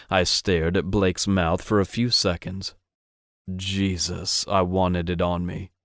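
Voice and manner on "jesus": ordinary delivery, nothing marked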